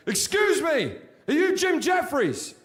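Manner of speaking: mock-polite soldier checking a name. Soldier greets him politely.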